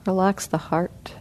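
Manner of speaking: soft, unhurried meditation guidance with long pauses; guided meditation. Acted. Teacher guiding the sitting group through body relaxation, soft and calm. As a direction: ease the group gently through each part of the body.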